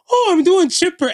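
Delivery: high, loud put-on cheeriness; fake chipper. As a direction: imitate someone insisting they're doing great. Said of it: "'Cause you be like".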